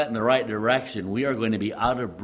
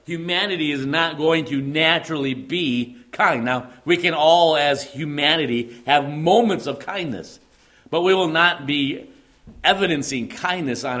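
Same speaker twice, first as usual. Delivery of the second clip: climbing higher and louder, pressing each phrase; preaching at full force; 5 semitones higher, 5 dB louder than usual. Acted. Preacher to congregation, rising to a loud fervent build. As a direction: hammer home that humanity is not kind.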